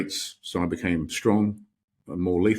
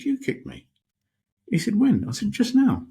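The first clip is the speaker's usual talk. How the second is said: much higher, louder indignant protest; insist, getting worked up; man protesting to the monk that kicked him; exasperated; 14 semitones higher, 3 dB louder than usual. Retold or imitated. Retelling exasperated argument with monk.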